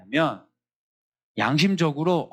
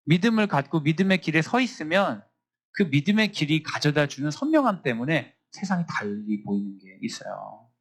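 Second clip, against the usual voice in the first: ordinary talk, nothing marked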